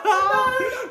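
squealing laugh of disbelief; high shocked laugh. Real, not acted. Laughs at the sad sentence. (hohes ungläubiges Lachen)

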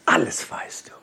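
voiceless whisper to himself; whispered self-talk. Retold or imitated. Telling himself he knows how to build a car: whispered. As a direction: hush it like a secret reassurance. Quoting his own inner voice.

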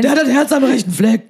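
high, loud rowdy fan bawl; bawling football fan. Pretend. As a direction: bellow it from the terraces. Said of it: "damit ihr mich versteht".

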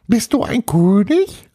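high, puzzled ghost voice; little castle ghost. Acted. Little ghost questions Paul, high-pitched puzzled voice. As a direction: ask it as a tiny puzzled ghost.

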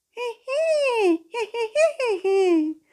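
very high tiny giggle; high squeaky giggle. Acted. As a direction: squeak out a little laugh. Ball character giggles.

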